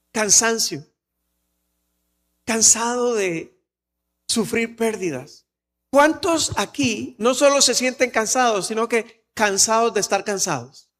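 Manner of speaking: ordinary delivery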